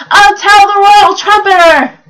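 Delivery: loud, high full-voiced shout. A narrator's reading. Reader redoing Bartholomew's line about the royal trumpeter, shouted. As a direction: shout it out like a storybook hero. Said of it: "he shouted".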